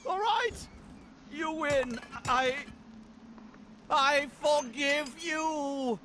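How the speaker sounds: grudging forced concession. Acted. Forced forgiveness under curse.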